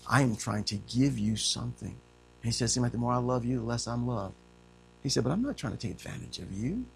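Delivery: soft, sincere, quiet Paul; Paul pleading with the church, soft and tender. Acted. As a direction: speak it gently and sincerely.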